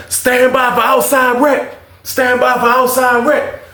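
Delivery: high, slow announcer call; prison announcement. Retold or imitated. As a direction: imitate a drawn-out intercom announcement. Imitates the prison rec announcement.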